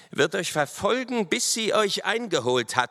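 high-pitched vow of revenge; threatening lieutenant. A narrator's reading. Lieutenant threatens captors with revenge, defiant.

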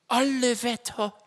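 high-pitched grumble of surprise; grumbling Hobble Frank. A narrator's reading. Frank reacts to being called boy, grumbling. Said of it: "brummte Hobble Frank".

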